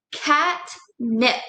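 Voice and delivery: catnip in two separate parts, cat and nip; split into syllables. Shown as a demonstration. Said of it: "Let's all go ahead and do that together".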